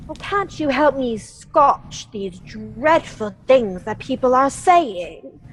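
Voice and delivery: normal talking voice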